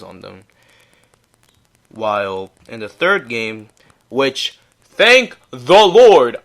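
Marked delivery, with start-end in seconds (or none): 4.8-6.4 relieved exclamation. Real, not acted. Relieved he skipped the loss.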